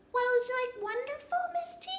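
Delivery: put-on high, chirpy little girl; child puppet voice. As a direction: play the curious puppet child. Puppet Annie voice.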